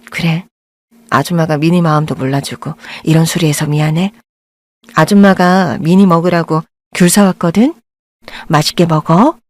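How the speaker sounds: hurt, gentle mother. Acted. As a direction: soothe the girl despite being rebuffed. Mother answers, hurt and soft.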